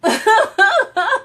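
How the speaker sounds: loud, high, stretched, laughing call; fangirl scream. Pretend. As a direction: shriek his name like a starstruck fan. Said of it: "I'll be like".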